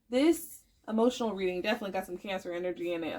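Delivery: usual speaking voice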